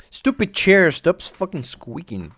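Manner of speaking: real annoyance at a noisy chair; brief real irritation flaring up; irritated outburst. Real, not acted.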